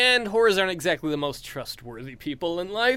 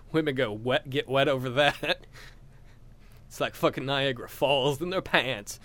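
ordinary talk, nothing marked

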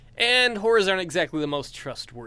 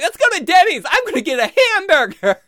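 much higher and much louder clueless glee; giddy high exclaim; 14 semitones higher, 10 dB louder than usual. Retold or imitated. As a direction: shout for the burger, laughing. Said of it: "I'm like".

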